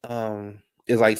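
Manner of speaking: normal talking voice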